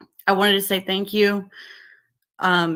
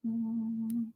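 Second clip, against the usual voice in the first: much softer, half to herself while busy; muttering to herself; 10 dB quieter than usual. Real, not acted. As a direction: mumble it aside, away from the mic. Fiddles with link while streaming.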